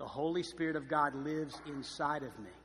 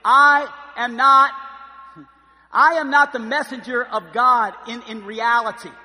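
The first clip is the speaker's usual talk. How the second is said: loud, higher, emphatic preaching; preaching at full force; 10 semitones higher, 20 dB louder than usual. Real, not acted. Pastor to congregation, loud emphatic preaching. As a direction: drive the point home to the congregation.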